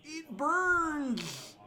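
high squealing It burns; shrill cartoon yelp; lisping cartoon cry. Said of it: "he has a lisp, and he just started going".

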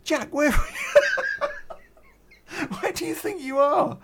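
laughing. Retold or imitated. Mocking reply to Jack.

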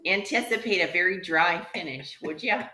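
put-on a lightly done Canadian accent reading wine notes; mock Canadian accent. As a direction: play up a cheerful Canadian accent. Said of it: "my little Canadian accent".

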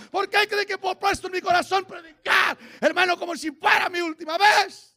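high, very loud sustained shouted preaching; full-force preaching. Real, not acted. Preacher vowing to live for God before the church, full-force shouting. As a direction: preach at the top of your voice.

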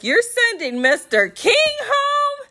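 very high, shrieked question breaking into laughter; shocked disbelief. Retold or imitated. Viewer reacting to Mister King being sent home, shocked disbelief. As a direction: squeal it in scandalized disbelief.

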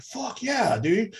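high, soft mock excitement; mock excited. Viewer gushing at the screen, mock excited. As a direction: gush like a thrilled teen.

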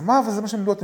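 high, loud burst of disbelief; astonished reaction. Retold or imitated. Student reacting to what he was taught, loud high disbelief. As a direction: blurt it out in astonishment.

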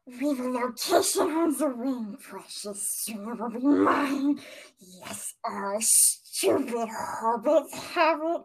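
slow, put-on Gollum voice, drawn out; Gollum impression. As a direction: hiss it out like Gollum gloating over the ring. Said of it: "This is Gollum speaking first".

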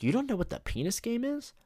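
high-pitched incredulous question; mock astonishment. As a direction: feign shock at a colleague, pitch leaping up. Principal feigns shock at colleague.

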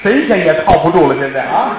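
ordinary delivery, nothing marked

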